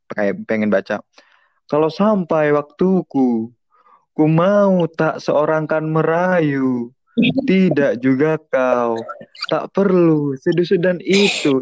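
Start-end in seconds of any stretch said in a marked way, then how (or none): 1.7-11.6 slow, sing-song, same tune each line; monotonous poem reading. Shown as a demonstration. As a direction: drone it out, crooning and monotonous. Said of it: "itu monoton banget". (baca puisi mendayu-dayu dan monoton)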